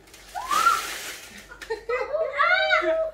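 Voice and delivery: high shrieking laugh; loud burst of laughter. Real, not acted.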